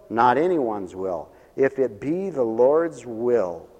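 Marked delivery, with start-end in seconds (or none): none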